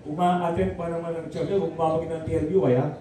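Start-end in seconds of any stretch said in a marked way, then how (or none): none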